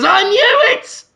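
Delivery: high, slow, hammed-up accusing shout; Hugo Weaving. Therapist casting out the exposed patient, outraged shouting. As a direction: denounce with drawn-out theatrical outrage. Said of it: "points at it and says".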